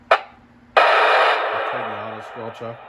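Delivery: ordinary talk, nothing marked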